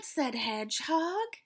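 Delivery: put-on expressive read-aloud for kids; storybook reading. A narrator's reading. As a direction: read it like a picture book to children. Teacher reads storybook to class.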